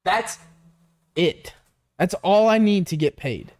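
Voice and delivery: emphatic, halting plea; exasperated insistence. Acted. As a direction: spell it out with pauses, fed up. Acted plea, spelled out.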